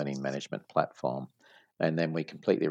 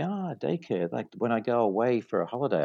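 higher-pitched voice of a customer catching on; imitated customer; 6 semitones higher than usual. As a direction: lightly play a customer guessing aloud. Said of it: "And they say".

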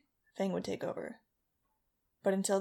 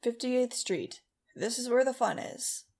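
over the PA; 4 semitones higher, slower than usual. Acted. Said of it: "the bus driver said over the P A system".

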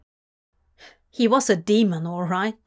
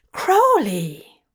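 louder, hurt, shocked cry; shocked gasp; 2 semitones higher, 5 dB louder than usual. Acted. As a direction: cry out his name, stung. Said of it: "Zira gasped, shocked and hurt".

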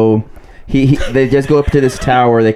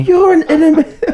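much higher hammed-up outraged shriek; mock yell. Podcaster imitating the film's furious boss, mock shrieking yell. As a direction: squeal it like a man losing it. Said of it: "yelling about his wife being an inanimate object".

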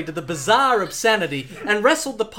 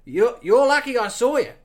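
higher, louder Aussie introduction; Australian accent; 4 semitones higher, 3 dB louder than usual. Acted. Pilot Steve-O introducing himself to Bond, Australian accent. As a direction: introduce yourself like a cheerful bloke. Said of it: "Australian accent".

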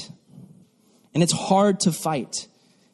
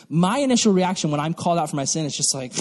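normal talking voice

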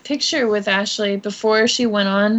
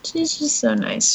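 ordinary delivery

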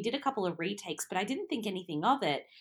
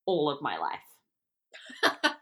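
laughing; 5 semitones lower, much slower than usual. Real, not acted. Self-mocking laugh.

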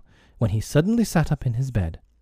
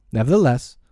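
ordinary delivery

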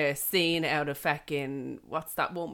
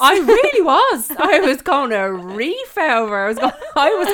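laughing indignation at full volume; worked up; 9 semitones higher, 11 dB louder than usual. Real, not acted. Recalls wanting to attack parent, laughing.

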